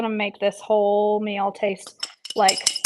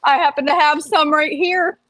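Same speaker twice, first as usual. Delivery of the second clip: cowboy sidekick, pitched higher; higher-voiced playful cowboy reply; Billy Bob; 5 semitones higher, 4 dB louder than usual. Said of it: "Billy Bob ... pulls out a wedge of laughing cow and says".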